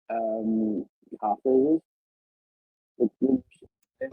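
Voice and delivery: muffled, like a hand over the mouth; muffled audio. Real, not acted. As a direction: speak through a muffled mic. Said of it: "your audio went muffled there".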